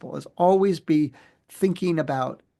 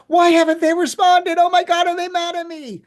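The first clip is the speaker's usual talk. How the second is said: high, loud, panicked rush of worry; anxious panic; 7 dB louder than usual. Retold or imitated. Employee fretting over boss's short email, panicked high squeal. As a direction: spiral into a frantic worried squeal. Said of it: "and the person's like".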